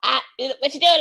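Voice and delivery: high, messy Australian-tinged impression; slurry attempt at an accent, landing Australian; botched Australian-sounding accent. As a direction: do a fucked-up lout, accidentally Australian. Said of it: "now all my accents are Australian".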